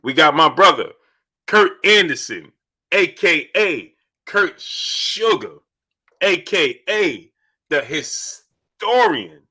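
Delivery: drawn-out announcer-style intro, high pitch; slow, grand build-up for a co-host; hyped introduction. Real, not acted. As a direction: stretch out each name like a ring announcer.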